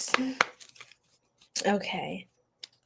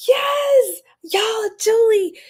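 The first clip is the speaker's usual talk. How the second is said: thrilled gasp; 10 semitones higher, slower than usual. Real, not acted. Thrilled by Julie's compliment.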